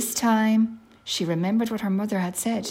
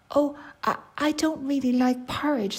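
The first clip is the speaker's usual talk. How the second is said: put-on hesitant, higher-pitched little girl; polite; 6 semitones higher than usual. Girl turns down porridge from the bears, polite. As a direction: decline politely, with a small stumble.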